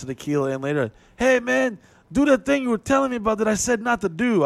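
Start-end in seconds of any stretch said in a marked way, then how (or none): 1.2-4.4 much higher, tipsy coaxing; drunk club owner. Retold or imitated. Drunk owner egging the comic on, pitched way up. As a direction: egg him on, pitched way up. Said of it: "This motherfucker, twelve fucking shots".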